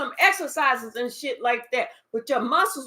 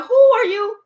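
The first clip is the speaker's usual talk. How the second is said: high, loud fake astonishment; a hammed-up who-are-you squeal; mock surprise; 6 semitones higher, 4 dB louder than usual. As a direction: play dumb in exaggerated disbelief. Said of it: "You was even like".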